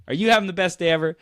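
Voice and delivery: high, loud, fast; eager greeting. Retold or imitated. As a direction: rush the question brightly. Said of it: "responds favorably".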